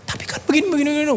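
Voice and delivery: very high, nagging rant; mock ranting. Husband still ranting at his wife in the car, very high nagging. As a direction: keep harping on it. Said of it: "Saya masih ngoceh aja".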